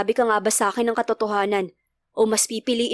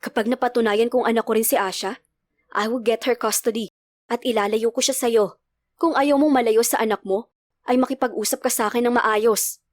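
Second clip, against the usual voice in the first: plain reading voice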